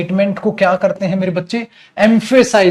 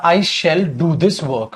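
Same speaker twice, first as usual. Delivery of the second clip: slow dictation; much slower than usual. Shown as a demonstration. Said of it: "मैंने एक statement लिखी".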